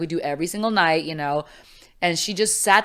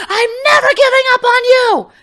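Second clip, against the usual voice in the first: cracking, much higher, louder, near tears; tearful vow; 11 dB louder than usual. Retold or imitated. Mother tearfully vows never to give up on her daughter. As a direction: fight through tears to promise. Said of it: "She goes".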